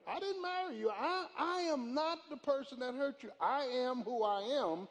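loud, rising sermon delivery with slowed punches; full-force preaching. Acted. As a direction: preach it hard at the congregation. Preaching to the congregation.

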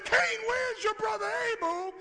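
very high, loud shouted question; God's question, preached at a shout; preaching shout. Acted. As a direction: cry it out at full force.

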